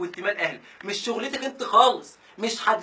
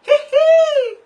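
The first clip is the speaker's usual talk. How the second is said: high squeaky cough imitation; 11 dB louder, much slower than usual.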